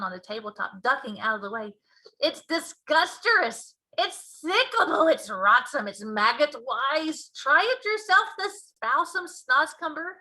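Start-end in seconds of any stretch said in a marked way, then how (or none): 2.2-10.1 loud, slow, disgusted giant; BFG disgusted. Acted. Giant offering Sophie the vegetable, disgusted. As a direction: relish every gross word.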